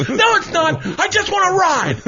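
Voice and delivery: put-on high pleading kid voice; childish whine. Bobby insisting he just wants to ride, childish whine. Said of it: "But he goes".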